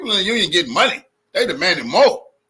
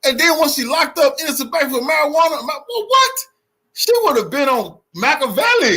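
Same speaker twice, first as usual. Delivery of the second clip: much higher, loud incredulous rant; outraged rant; 10 semitones higher, 4 dB louder than usual. Real, not acted. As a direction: shout it out in disbelief. Outraged rant about [name].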